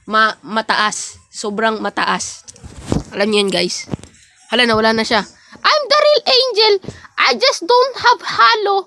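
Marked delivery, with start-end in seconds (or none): 5.6-8.8 high, squeaky voice insisting, rising to a shout; a petty character claiming to be the real angel. Acted. Two angels bickering over who is real, in a squeaky voice.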